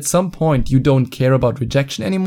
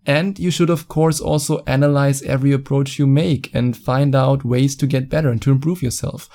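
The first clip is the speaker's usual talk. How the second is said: ordinary talk, nothing marked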